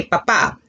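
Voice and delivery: papá with the second syllable stressed; stress on final A. Shown as a demonstration. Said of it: "important that you accent the A".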